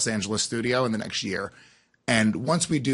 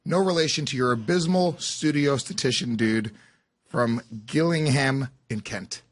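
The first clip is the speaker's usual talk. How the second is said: a touch of read-aloud cadence; reading a letter aloud. Acted. Co-host reads a listener's question on air, measured read.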